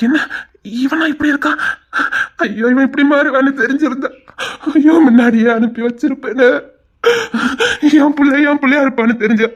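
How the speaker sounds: loud crying lament; wailing lament. Acted. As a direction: wail it out in grief. Grieving mother laments her son. (உரத்த அழுகையுடன் புலம்பல்)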